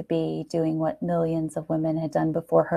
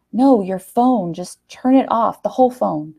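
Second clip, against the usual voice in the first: loud, urgent insistence; 4 semitones higher, 7 dB louder than usual. Acted. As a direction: press her to do it now.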